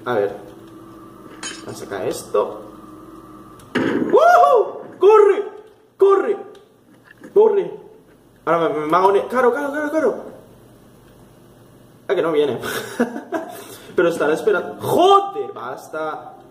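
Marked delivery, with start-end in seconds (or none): 3.7-7.7 high urgent yells, easing on the last; shriek, then urge flight in separate bursts; panicked shouting. Real, not acted. Player fleeing the threat, panicked high shouts. 9.3-10.1 flustered high repetition. Real, not acted. Flustered while being chased. 14.8-15.5 sudden high curse; startled yell. Real, not acted. Player caught off guard mid-sentence, high startled yell. Jump scare.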